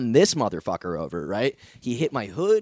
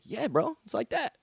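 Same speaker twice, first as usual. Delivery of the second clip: high, cocky comeback; mock tough reply; 10 semitones higher, 5 dB quieter than usual. Comedian answering the fan, high cocky comeback. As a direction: toss it back with cocky swagger.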